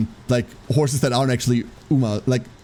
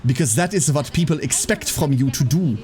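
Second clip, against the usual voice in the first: movie bad-guy German accent, overstressed consonants. Shown as a demonstration. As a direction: put on a thick villainous German accent, every TH a Z. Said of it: "the bad guy accent would be like this".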